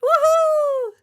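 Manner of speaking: very high, joyful whoop; children bursting out cheering; loud cheer. A narrator's reading. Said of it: "let out a loud cheer".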